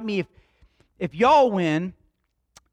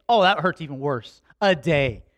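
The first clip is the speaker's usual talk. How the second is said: ordinary talk, nothing marked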